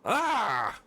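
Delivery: sharp high cry of pain. Acted. Nova, eye burnt by the sun, crying out in pain.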